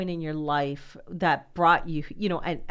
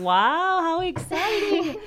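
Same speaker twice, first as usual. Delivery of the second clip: delighted wow, much higher and louder, drawn out slowly; real excitement; 11 semitones higher, 7 dB louder, much slower than usual. Real, not acted. Interviewer hearing of her acceptance to a master's, excited. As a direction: gush with real delight over her news.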